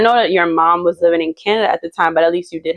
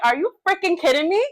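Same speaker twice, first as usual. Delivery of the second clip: incredulous; 9 semitones higher, 3 dB quieter, faster than usual. Retold or imitated. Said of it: "And I'm like".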